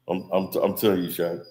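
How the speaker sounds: a hint of Shannon bragging how tough he is; Shannon Sharpe impression. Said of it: "Shannon Sharpe even talking about".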